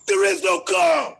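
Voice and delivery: loud, slow, explosive yell; shout. Acted. To the man telling him to calm down, furious shout. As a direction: blast it out at full force. Climactic outburst.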